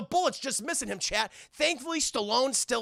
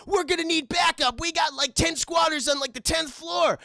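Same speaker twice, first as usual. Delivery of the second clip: higher-pitched imitation of a radio call; urgent backup call; 7 semitones higher than usual.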